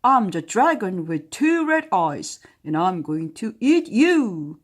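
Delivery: loud boastful dragon roar. Acted. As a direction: bellow it at the princess. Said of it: "spit fire".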